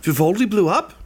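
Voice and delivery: high put-on gnome voice, rising in mock shock; gnome feigned surprise. Peachtree faking surprise at the explosion. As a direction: act startled by the news.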